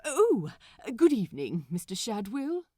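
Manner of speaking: overly proper, a bit rattled; flustered Aziraphale. Acted.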